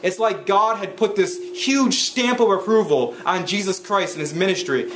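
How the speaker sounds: ordinary talk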